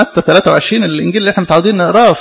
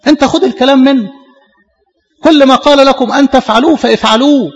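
higher, insistent preaching; emphatic quoting; 7 semitones higher than usual. Acted. Preacher voicing Christ to the congregation, higher and insistent. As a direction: drive the point home, higher.

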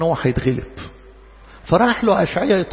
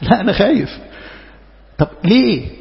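ordinary talk, nothing marked

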